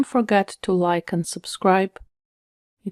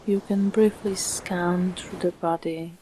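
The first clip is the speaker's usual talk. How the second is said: calm meditation voice with long, unhurried pauses; soothing, evenly paced relaxation guidance; guided meditation; 5 dB quieter than usual. Acted. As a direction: guide the listener gently into stillness, phrase by phrase.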